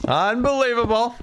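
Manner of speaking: high, sustained exclamation; a big put-on "unbelievable"; mock amazement. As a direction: draw it out high and theatrically. Repeats word in mock outburst.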